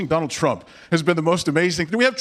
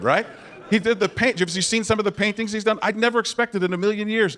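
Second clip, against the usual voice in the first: ordinary talk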